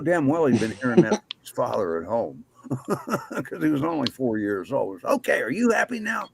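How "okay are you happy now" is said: put-on little boy sassing. Retelling boy's sassy line.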